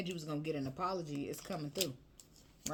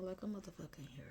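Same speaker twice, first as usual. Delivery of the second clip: softer, breathed-out confession; emotional whisper; 3 semitones higher, 10 dB quieter than usual. Real, not acted. Admits to viewers she is moved, in a whisper. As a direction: whisper it, overcome for a moment. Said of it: "I got emotional".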